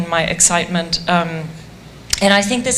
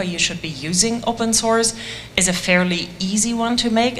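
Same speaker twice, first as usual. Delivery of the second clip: ordinary delivery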